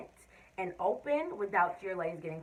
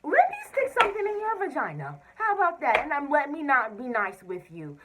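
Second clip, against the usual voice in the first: mocking the doctor; 5 semitones higher, 6 dB louder than usual. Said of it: "she really just".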